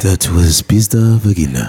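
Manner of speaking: low, slow radio announcer; back-announce the aria with mock gravity; opera station announcer. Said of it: "those opera stations".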